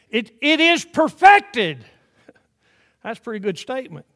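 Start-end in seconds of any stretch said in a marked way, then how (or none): 0.1-1.8 loud, high, slow emphatic blurt. Retold or imitated. Polish convert blurting the phrase, loud and emphatic. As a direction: burst it out with full force. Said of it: "finally blurted it out".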